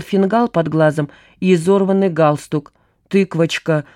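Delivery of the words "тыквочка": ordinary delivery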